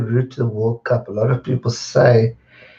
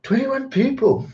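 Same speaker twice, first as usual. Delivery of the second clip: excited surprise; 13 semitones higher than usual. Real, not acted. Viewer count jumps during stream.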